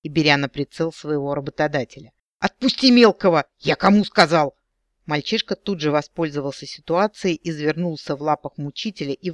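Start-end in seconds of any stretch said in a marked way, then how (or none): 2.4-4.5 shouting. Acted. Frank threatening with a gun.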